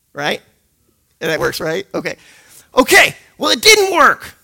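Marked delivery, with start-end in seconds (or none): 2.8-4.3 higher, louder burst of disbelief; emphatic preacher shout; loud exclamation. Real, not acted. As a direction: throw it out to the room.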